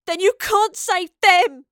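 high, drawn-out furious scream; screamed accusation. A narrator's reading. Astrid turning on the professor over the girls, screaming. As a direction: hurl it at full volume. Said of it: "Astrid screamed".

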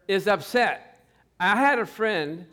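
normal talking voice

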